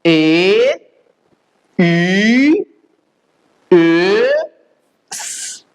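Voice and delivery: ए, ई, ए each held long, then a hissed स; long German umlauts, स as a drawn hiss; umlaut sound demo. Shown as a demonstration. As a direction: sustain the umlaut vowels ए, ई, ए separately. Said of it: "इसका pronunciation क्या होगा".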